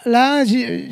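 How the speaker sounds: usual speaking voice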